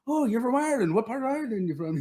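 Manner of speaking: imitating a fake Irish accent, pitched high; a friend hamming up an Irish accent. Friend faking an Irish accent to a real Irish guy, high mock Irish lilt. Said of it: "he'd be in an Irish accent all night".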